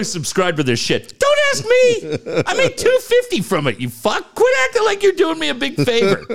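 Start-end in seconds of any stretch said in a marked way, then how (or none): 1.2-6.2 high mock complainer, half laughing; mocking whiny subscriber.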